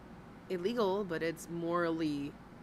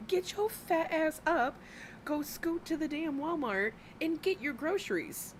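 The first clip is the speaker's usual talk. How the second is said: mock scolding; 10 semitones higher than usual. Scolding the streamer to shop herself, higher bossy nag. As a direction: nag her like a fed-up mom.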